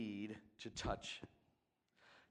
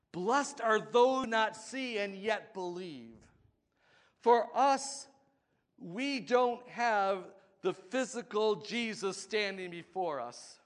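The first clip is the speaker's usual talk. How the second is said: loud, higher preaching build; full-force preaching; 8 semitones higher, 10 dB louder than usual. Acted. Preacher driving the warning home to the congregation, loud. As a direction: preach it to the rafters.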